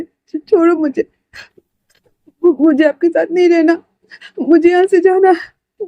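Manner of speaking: high, slow, tearful stammering pleas; sobbing panic. Acted. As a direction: break down crying and beg to leave. Crying, panicked outburst. (रोते हुए, घबराकर ऊँची आवाज़ में गिड़गिड़ाना)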